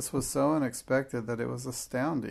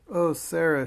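higher, louder startled exclamation; exclaiming; 5 semitones higher, 7 dB louder than usual. A narrator's reading. Headmistress catching a pupil in the hall, exclaiming. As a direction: exclaim it, caught off guard. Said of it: "she exclaimed".

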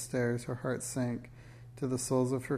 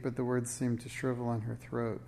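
ordinary delivery, nothing marked

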